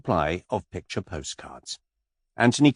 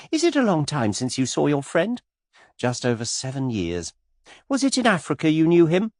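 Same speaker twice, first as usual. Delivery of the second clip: put-on much higher, girlish gushing voice; skittish young lady; 8 semitones higher than usual. A narrator's reading. Miss Taylor pestering Mister Cade, high and gushing. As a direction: flutter up high like a giddy girl.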